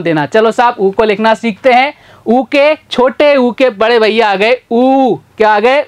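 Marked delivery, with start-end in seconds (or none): none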